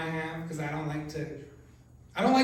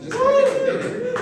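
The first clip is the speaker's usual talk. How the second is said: much higher, louder demand; calling out crowd; 8 dB louder than usual. Acted. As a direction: push the crowd to confess.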